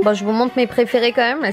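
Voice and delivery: ordinary talk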